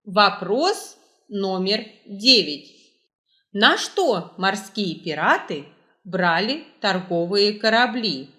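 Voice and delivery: ordinary talk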